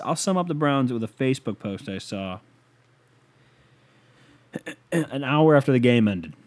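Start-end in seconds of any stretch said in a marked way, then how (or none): none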